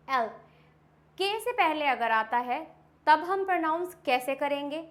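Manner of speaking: usual speaking voice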